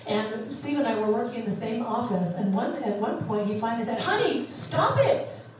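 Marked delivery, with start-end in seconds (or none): 4.0-5.5 high, slow exclamation; a mild mock of a husband pleading; husband's urgent plea. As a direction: imitate him pleading hard. Husband scolding her, quoted.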